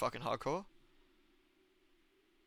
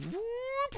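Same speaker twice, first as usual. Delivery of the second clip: high imitated whoop; 3 dB quieter than usual. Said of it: "And she's like".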